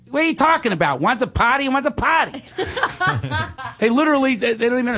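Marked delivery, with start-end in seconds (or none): 0.1-2.4 high, rapid-fire Long Island tough talk; exasperated Long Island local; Long Island accent. Acted. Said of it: "or Long Island, I would actually say".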